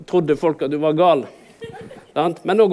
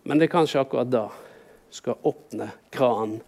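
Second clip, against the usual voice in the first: usual speaking voice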